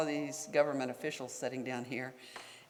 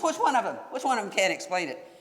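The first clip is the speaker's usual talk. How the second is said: a retold angry demand, much higher and louder; angry outburst; 6 semitones higher, 4 dB louder than usual. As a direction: imitate the secretary glaring at his staff. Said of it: "he was just so angry".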